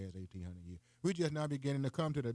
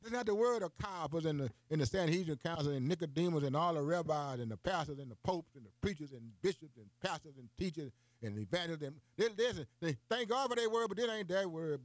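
climbing, higher preaching run; rising preaching; 5 semitones higher than usual. Real, not acted. Preacher to listeners, rising preaching. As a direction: preach it up higher, piling up the list. Preacher building to full force.

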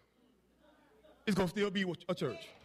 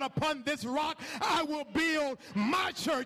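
fiery pulpit delivery; preaching at full force; 6 semitones higher than usual. Acted. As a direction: preach it over the organ to the whole church. Pastor preaching to congregation.

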